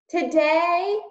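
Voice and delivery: high loud shout. Acted. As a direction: call after them with all she has. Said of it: "shouted Meg".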